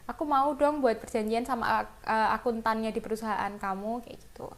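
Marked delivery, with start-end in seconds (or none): none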